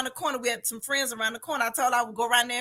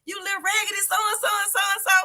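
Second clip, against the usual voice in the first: mock scolding; 8 semitones higher, faster than usual. Woman voices the man threatening the trapped child, high mock scolding. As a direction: imitate a cross man telling off a kid.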